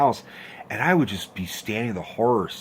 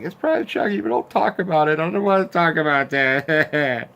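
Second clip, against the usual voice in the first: put-on scared kid voice; 7 semitones higher, 4 dB louder than usual. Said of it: "and I was like".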